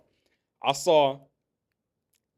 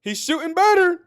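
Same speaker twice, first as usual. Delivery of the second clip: mock objector; 9 semitones higher, 7 dB louder than usual. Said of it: "So you might say".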